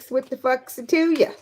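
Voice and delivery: mock country lady telling folks off; country accent. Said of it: "Am I still talking country?".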